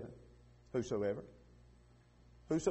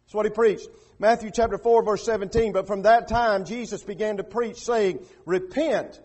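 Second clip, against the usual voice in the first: raised, driving sermon voice; forceful preaching; 5 semitones higher, 8 dB louder than usual. Real, not acted. Preacher citing scripture to the congregation, forceful preaching. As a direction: preach it out, louder on the key phrases.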